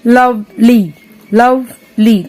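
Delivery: Lovely broken as "love... ly"; split into syllables. Shown as a demonstration. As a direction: say Lovely in two separate beats, love, ly. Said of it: "टू syllables".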